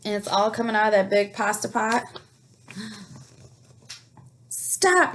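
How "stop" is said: yelled stop. A narrator's reading. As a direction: shout it at the runaway pot. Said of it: "yelled Big Anthony".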